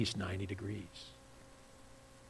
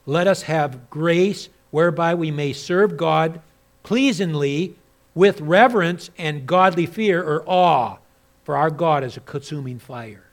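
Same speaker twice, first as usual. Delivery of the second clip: preaching scripture; 8 semitones higher, 15 dB louder than usual. Acted. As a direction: proclaim the verse with awe.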